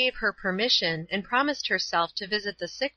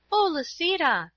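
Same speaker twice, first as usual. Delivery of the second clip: high-pitched appeal to a cousin; pleading cry; 9 semitones higher than usual. A narrator's reading. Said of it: "cried Paula".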